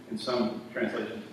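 usual speaking voice

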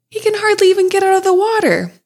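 high, fast mocking princess voice; squeaky scornful princess; cartoon princess. Princess mocks frog in thought.

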